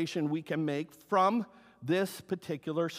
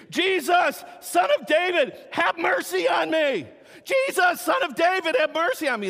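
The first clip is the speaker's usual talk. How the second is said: high, loud desperate cry; yelled plea, high and loud; screaming for mercy; 12 semitones higher, 8 dB louder than usual. Retold or imitated. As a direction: shout it like a blind beggar begging Jesus. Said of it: "he starts screaming".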